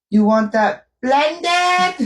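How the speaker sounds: high, slow mock of the lady's heavy accent; customer-imitating drawn-out question. Friend imitates the lady taking their order, drawn-out high question. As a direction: start out calm, then stretch "blended" high. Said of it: "she was all calm in the beginning".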